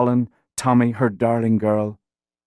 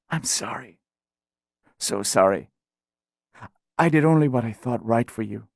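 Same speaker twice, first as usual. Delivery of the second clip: faint apology; 3 dB quieter than usual. Acted. Dying mother apologizing softly.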